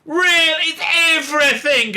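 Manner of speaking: high, loud sarcastic disbelief; incredulous shout. Real, not acted. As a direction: hurl a scornful really. Incredulous outburst at tokenism.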